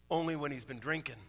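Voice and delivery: low, soft deadpan reply; understated punchline. Acted. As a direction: drop the punchline quietly. Wife's quiet punchline in joke.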